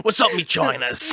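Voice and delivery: mock Cockney greeting; Cockney accent. Alien greeting its mates, Cockney accent. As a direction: greet them in put-on Cockney. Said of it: "they're never Cockney".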